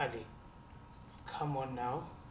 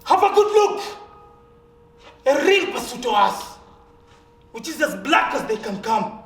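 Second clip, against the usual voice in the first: loud and high, humiliated rage; bitter fury; 5 semitones higher, 12 dB louder than usual. Acted. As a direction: throw it at him, loud. Sam bares himself, furious.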